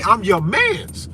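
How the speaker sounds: slow mock friendly promise; slow drawn-out reassurance. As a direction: drag out each word slowly.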